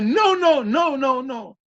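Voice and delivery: high, drawn-out mock wail; panicked refusal. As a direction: act out pleading refusal, each no running on. Acts out her panicked refusal. (negativa lastimera imitada)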